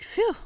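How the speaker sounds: high, blown-out whew; exaggerated exhale of relief; relieved phew. Retold relief at being let go.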